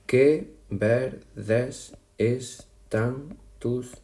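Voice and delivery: very slow, each syllable drawn out; very slow reading. Shown as a demonstration. Teacher to students, reading the example verse very slowly. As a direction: stretch the line out to count it. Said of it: "Nos saldría una".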